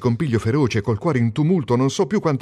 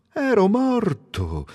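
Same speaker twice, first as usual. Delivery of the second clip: ero morto drawn out slowly and high, stress on ero; slow, higher echo of ero morto; slow marveling repeat; 7 semitones higher, much slower than usual. Acted. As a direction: savour the realisation.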